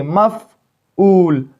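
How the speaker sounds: मफ़ऊल drawn out very slowly, each syllable held; slow syllable-split demo. Shown as a demonstration. Teacher to pupils, slowly sounding out the word. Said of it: "इस तरह मैं आपको समझाऊंगा".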